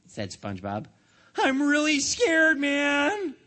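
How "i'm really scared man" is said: scared. Acted. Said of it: "cried the customer".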